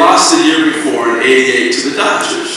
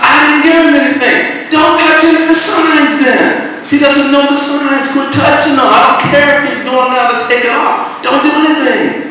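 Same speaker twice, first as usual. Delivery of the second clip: angry yelling; 12 semitones higher than usual. Retold or imitated. La Russa yelling at the third base coach, heated shout. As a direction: scold him like a furious boss. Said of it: "they're yelling at each other".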